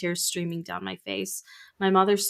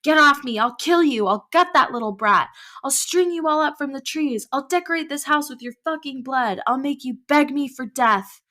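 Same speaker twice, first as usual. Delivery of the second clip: high, loud raging threats; growled threats; 8 semitones higher, 6 dB louder than usual. Acted. Possessed Jim threatening the family, raging shouted threats. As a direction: spit every threat out with fury. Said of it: "scratchy and growled".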